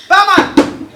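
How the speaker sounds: loud call across the bar. Real, not acted. Man calling the barman across the bar, loud. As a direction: shout for the barman to come over.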